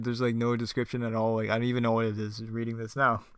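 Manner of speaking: plain reading voice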